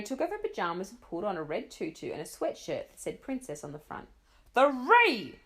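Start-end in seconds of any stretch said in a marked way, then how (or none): 4.5-5.4 very loud counted number; mother screaming the countdown. Acted. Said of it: "screamed her mother".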